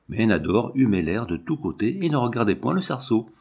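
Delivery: usual speaking voice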